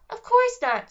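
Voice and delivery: fairy insulted at the very idea; indignant fairy. A narrator's reading. Fairy denies killing anyone.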